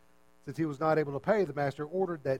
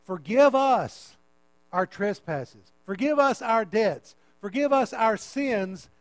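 prayer recitation; 3 semitones higher, 5 dB louder, slower than usual. Acted.

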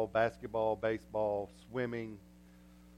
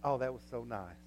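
mock polite murmur; 5 semitones lower, 8 dB quieter than usual. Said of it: "we go back and go".

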